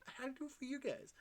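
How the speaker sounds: very high, fast mock devotion; squeaky high rush. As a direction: squeal it out quickly. Higher faster repeat.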